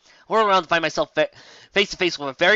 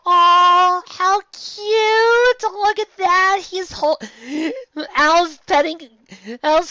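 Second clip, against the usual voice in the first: much higher, louder gushing squeal; squealing delight; 11 semitones higher, 4 dB louder, slower than usual. Real, not acted. Fan gushes over the brothers petting kittens. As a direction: squeal over something adorable. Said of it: "fangirling over kittens".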